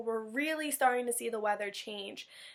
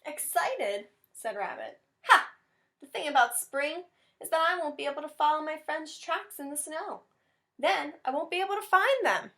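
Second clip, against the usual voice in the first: higher, put-on voice for a sulky rabbit; grumpy rabbit; 4 semitones higher, 3 dB louder than usual. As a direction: scoff at spring like a grumpy bunny. Rabbit complains about spring.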